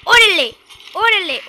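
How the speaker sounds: high, loud excited yell; excited shout. Real, not acted. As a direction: shout it in hype. Excited reaction in the game.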